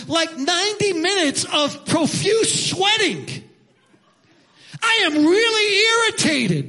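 high, slow, mock-irritated outburst; irritated rant. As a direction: ham up the irritation, high and stretched out. Comic rant about the sweaty chore.

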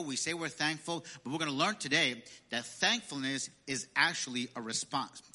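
normal talking voice

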